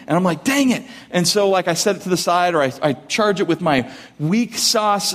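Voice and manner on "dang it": higher, exasperated dang it; mock irritation at the phone; a burst of mild annoyance; frustrated exclaim. Phone battery drained again.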